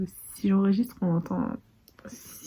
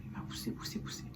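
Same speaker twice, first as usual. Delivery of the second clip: whispering; 8 dB quieter than usual. Real, not acted. Quiet talk, baby nearby.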